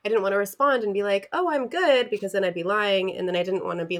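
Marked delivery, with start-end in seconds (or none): 1.3-2.1 mock breezy, pitched up; fake cheeriness. Friend explaining replies they avoided, high fake-cheerful voice. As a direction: imitate a falsely bright brush-off.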